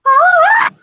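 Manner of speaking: very loud, high-pitched "Ah!"; high mock scream. Player showing off charisma card, mock scream. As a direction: shriek it out for show.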